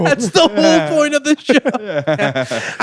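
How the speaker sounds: high, drawn-out mock protest; exasperated producer. Producer protesting to a contestant on a blind dating show, high mock wail.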